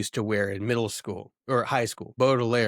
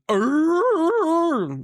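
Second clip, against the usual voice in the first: high, loud mock dog howl; beagle yelling; 6 dB louder than usual. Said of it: "a beagle that just goes".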